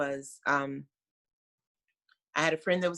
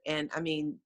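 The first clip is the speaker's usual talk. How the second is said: ordinary delivery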